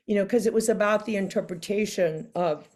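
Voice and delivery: ordinary delivery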